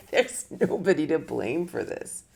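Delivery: a laugh carried into the words; amused at your own realization. Real, not acted. Laughing at herself.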